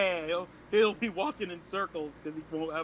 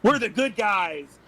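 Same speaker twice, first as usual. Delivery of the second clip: louder, slower, higher insistence; mock-righteous; 4 semitones higher, 6 dB louder, slower than usual. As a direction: drag it out with mock innocence. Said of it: "repeated insistence".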